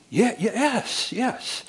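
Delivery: high, slow relieved yes; wife agreeing with the counselor, high and slow. Retold or imitated.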